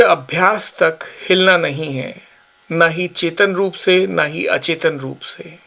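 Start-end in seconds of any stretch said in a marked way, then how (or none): none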